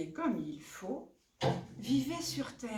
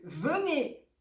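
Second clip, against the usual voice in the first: calling out; 5 semitones higher, 5 dB louder than usual. Acted. Crow summons family to feast.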